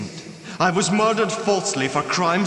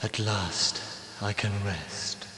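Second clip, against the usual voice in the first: much softer, lower dying sigh of relief; fading relief; 10 semitones lower, 10 dB quieter, slower than usual. Acted. Ghost released from torment, fading soft relief. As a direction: let it drift away into silence.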